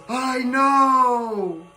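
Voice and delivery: high called-out shout from above; playful shout. Acted. Toy pilot shouting down from the air, playful high shout. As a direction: call it down from up in the sky. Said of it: "shouts from up, up in the air".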